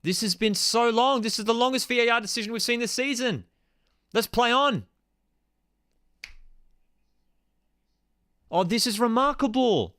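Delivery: fed-up fan begging the referees to get on with it; exasperated rant. Real, not acted. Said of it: "I'm still freaking pissed".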